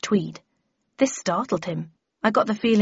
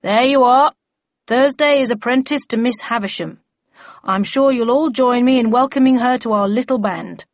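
louder, higher public announcement; bellman welcoming a recruit; 5 semitones higher, 7 dB louder than usual. Acted. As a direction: present a newcomer to the room.

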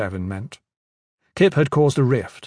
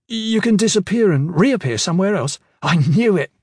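eager, higher and louder guess; excited; 7 semitones higher, 5 dB louder than usual. A narrator's reading. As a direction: blurt it out triumphantly. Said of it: "Kip said".

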